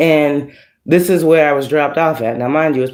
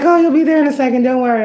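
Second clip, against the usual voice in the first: breezy stranger; 9 semitones higher, much faster than usual. Retold or imitated. Strangers reassure her in elevator.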